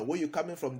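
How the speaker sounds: ordinary delivery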